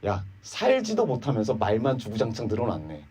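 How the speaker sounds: soft, scornful aside; sneering jab. Acted. Friend mocking Job's empty words, quiet sneer. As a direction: toss it off with quiet contempt. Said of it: "한마디로 뭡니까?".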